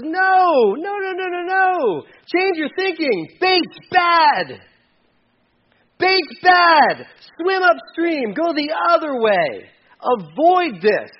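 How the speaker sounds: high, shouted warning as God; urgent warning. Acted. God warning people through Paul, urgent shout. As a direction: shout them away from danger, high and forceful. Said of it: "speaking through Paul, says".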